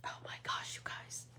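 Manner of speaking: excited whisper to the viewers; a gleeful secret whisper. Real, not acted. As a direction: breathe it out, thrilled and hushed.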